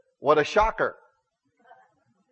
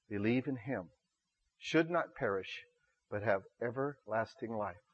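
hushed scripture recital; 8 semitones lower, 13 dB quieter than usual. Real, not acted. Preacher telling congregation God so loved them, hushed and solemn. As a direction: let each phrase fall quietly, with pauses. Solemn verse at sermon's climax.

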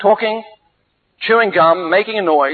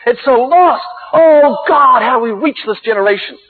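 retold desperate prayer, swelling on each plea; anguished crying out; 4 semitones higher than usual. Preacher retells his prayer for a lost generation, anguished cry. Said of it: "I began crying".

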